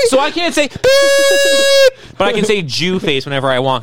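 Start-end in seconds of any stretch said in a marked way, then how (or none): 0.7-2.2 high, loud beep sound; high censor beep. Pretend. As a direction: squeal out a bleep like a censor. Imitating a censor bleep.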